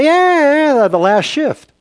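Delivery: very high, loud, drawn-out yeah; hammed-up high-pitched confirmation; mock eager insistence. Acts himself insisting to staff.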